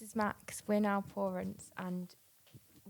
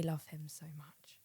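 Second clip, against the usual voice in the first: whisper; 4 semitones lower, 12 dB quieter than usual. Real, not acted. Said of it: "I told you that you're meant to give me the mic".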